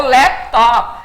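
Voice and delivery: "laptop" ending on a hard, unreleased P; clipped English P. Shown as a demonstration. Teacher demonstrating the final P in "laptop". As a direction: stop dead on the final P. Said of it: "tem que parar no P".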